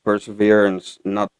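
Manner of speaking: ordinary talk, nothing marked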